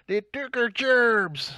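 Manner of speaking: high, loud, drawn-out cartoon yell; South Park parody. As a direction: holler it like an outraged cartoon character. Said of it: "There's a South Park episode about it".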